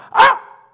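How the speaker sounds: sudden high, loud cry of pain; short mock "ah!" of shock; startled yelp. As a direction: imitate a child yelping from a smack. Child slapped on forehead, acted.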